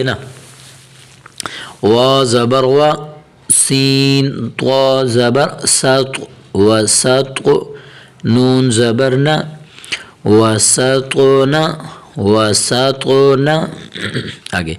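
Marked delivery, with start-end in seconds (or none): 1.8-13.6 measured letter-by-letter chant; teacher's drawn-out recitation; Quranic spelling recitation. Shown as a demonstration. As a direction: sound out each syllable with held endings. Spells out Quranic words for learners.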